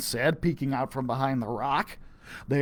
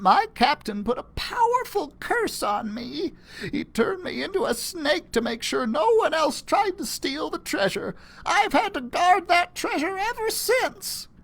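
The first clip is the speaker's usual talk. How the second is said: put-on higher old woman's voice; old lady; 12 semitones higher than usual. Old lady explains her curse, higher voice. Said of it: "the lady said".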